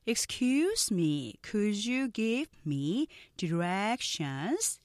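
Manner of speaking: very slow, stretched English for repeating; slow, deliberate pronunciation demo; slow-speed model. Shown as a demonstration. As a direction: draw out every word for learners to follow. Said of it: "먼저 느린 속도 발음 듣고".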